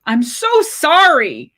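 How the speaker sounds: high, loud, slow horrified apology; horrified cry. Acted. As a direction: plead in panic. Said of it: "cried Lindsay, trembling with horror".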